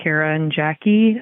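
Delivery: ordinary delivery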